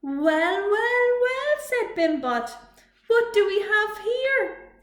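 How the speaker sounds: high, loud, slow and sing-song; little robot gloating at the bullies; smug gloating. A narrator's reading. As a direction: gloat over them sweetly. Bimbot gloats.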